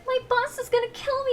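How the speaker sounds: very high sweet panicky voice; bubbly cashier in fake distress; cute customer service voice. Shown as a demonstration. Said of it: "all cute".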